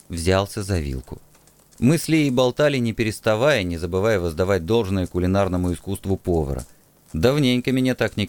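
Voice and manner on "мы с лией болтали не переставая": usual speaking voice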